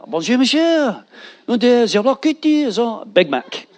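pastor's mock French accent. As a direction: order a burger in hammed-up French. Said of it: "in his French walk".

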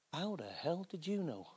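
very soft retold disbelief; stunned hush. Lad stunned that preacher knows his secret, hushed. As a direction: act out being floored, almost under the breath.

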